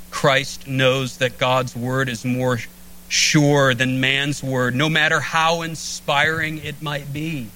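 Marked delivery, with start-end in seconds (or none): none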